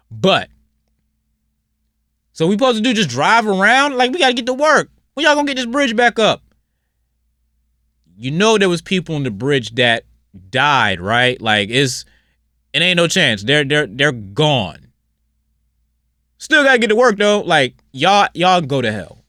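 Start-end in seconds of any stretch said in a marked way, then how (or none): 2.3-6.4 mock whiny commuter. Mocking selfish commuters again. 16.4-17.4 much higher, louder put-on complaining voice; mock whiny commuter. Mocking selfish commuters again.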